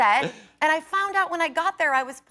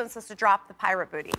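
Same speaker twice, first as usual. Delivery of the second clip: normal talking voice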